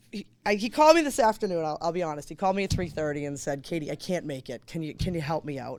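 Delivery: usual speaking voice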